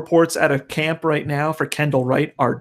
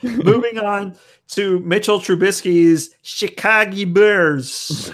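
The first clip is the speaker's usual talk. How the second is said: put-on thick Chicago accent; broad Chicago accent; 4 semitones higher, slower than usual. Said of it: "the best Chicago accent I've ever heard".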